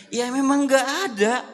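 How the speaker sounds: Prophet's mock-stern answer; firm reply. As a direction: tease her firmly.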